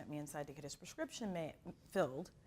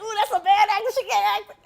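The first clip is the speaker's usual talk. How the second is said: loud, high mocking verdict; sassy critique; 13 semitones higher, 22 dB louder than usual. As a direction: friends in the theater roasting a bad actor loudly. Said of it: "We're like".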